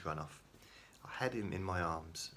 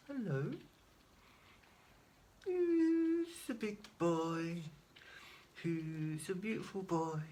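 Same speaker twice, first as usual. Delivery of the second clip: put-on baby talk; 14 semitones higher, slower than usual. Cooing baby talk to pet.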